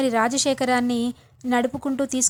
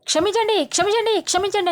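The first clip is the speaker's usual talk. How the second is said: much higher, louder, yelled apology; a mock-dramatic apology shouted for effect; 8 semitones higher than usual. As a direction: bellow the sorry at full volume. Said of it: "గట్టి గట్టిగా అరుస్తాడు".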